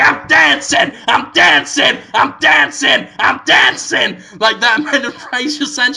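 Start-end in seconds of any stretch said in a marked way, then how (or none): 0.0-4.2 high, loud chant that repeats; gleeful shout. Retold or imitated. Flower chanting from the desk, loud gleeful shouts. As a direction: shout it like a party that won't stop. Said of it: "constantly going".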